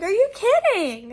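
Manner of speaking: high, loud disbelief; appalled, voice leaping up; incredulous outburst. Real, not acted. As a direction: exclaim in sheer disbelief at the nurse. Teller exclaims at nurse's careless answer.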